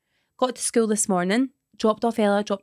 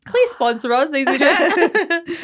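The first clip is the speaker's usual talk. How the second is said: mock plea; 8 semitones higher, 7 dB louder, slower than usual. Begging sponsor plea.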